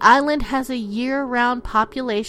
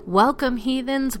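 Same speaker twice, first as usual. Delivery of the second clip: ordinary talk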